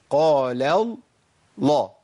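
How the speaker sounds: slow قال الله split before the heavy lam of الله; heavy first lam. Shown as a demonstration. Teacher breaks it down for students, both lams of الله heavy. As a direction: stretch قال, then land thickly on الله. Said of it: "يبقى لازم أفخم اللام الأولى".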